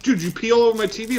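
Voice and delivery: high, fast disbelieving yell; shocked outburst. Retold or imitated. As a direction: blurt it out in shock. Retold angry friend quote.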